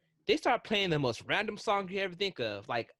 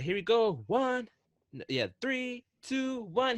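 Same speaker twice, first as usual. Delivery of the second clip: higher, eager countdown as Rocket; 7 semitones higher, slower than usual. Retold or imitated. Imitating Rocket counting down to the drop, lightly voiced. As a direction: count down like the ride's raccoon host. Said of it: "he's like".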